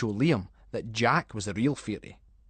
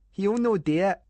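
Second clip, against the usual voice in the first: taunting; 8 semitones higher, 4 dB louder than usual. Acted. Liam taunting Callum.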